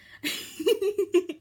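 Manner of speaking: loud bright burst of laughter. Real, not acted. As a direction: laugh at yourself. Laughing at herself.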